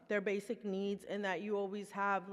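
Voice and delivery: ordinary talk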